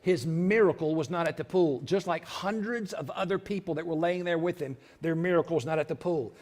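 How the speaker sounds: usual speaking voice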